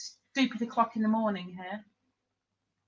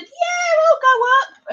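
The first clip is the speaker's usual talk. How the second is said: eager yes; 9 dB louder, slower than usual. Retold or imitated. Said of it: "and I've said".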